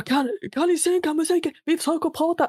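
put-on a touch of a cranky older man; annoyed neighbour. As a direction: lightly play the fed-up neighbour. Neighbour complaining about loud music.

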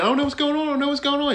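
high-pitched insistent denial; mock innocent wife. Wife kept saying it to husband, mock innocence. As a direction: repeat it in a high put-on voice. Imitates wife repeatedly denying.

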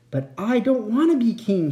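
ordinary delivery, nothing marked

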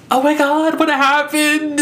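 high, slow, overdone worry from mom; a mother's fake alarm, played high and slow; mock concern. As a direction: ham up a suddenly concerned mother. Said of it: "so concerned".

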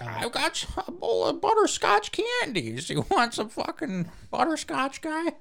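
high squeaky put-on voice offering candy; squeaky mock candy-pusher; mock coworker voice. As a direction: pitch it way up like a pushy coworker. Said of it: "I'm gonna be like".

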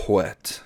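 "wet" opened with an airy "hw"; breathy HW. Acted. Narrator ends a description, "wet" said as "hwet". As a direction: puff air before the vowel in "wet". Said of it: "H W H E T".

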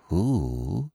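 hushed, breathless little question; low thrilling voice. A narrator's reading. Miss Peavy at breakfast, asking in a low thrill. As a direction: drop the voice to a soft thrill. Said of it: "in a low, thrilling voice".

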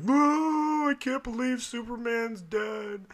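high, wailing mock sob through the words; mock blubbering. Dad imitating himself sobbing at a movie, mock blubbering. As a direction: ham up ugly crying over a dead hero. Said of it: "like, blubbering".

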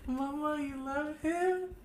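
put-on high-pitched whiny child voice, slow and pleading; crying little boy. Nephew crying at his mom, high whiny child voice. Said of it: "my fucking nephew just starts crying".

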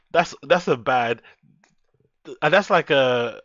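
ordinary delivery

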